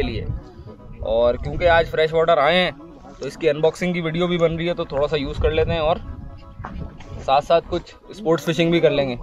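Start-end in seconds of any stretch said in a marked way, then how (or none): none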